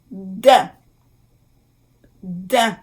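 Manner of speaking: "dain" in French, nasal vowel; careful French pronunciation. Shown as a demonstration. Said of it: "I M".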